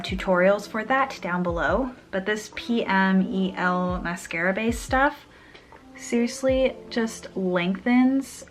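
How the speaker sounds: normal talking voice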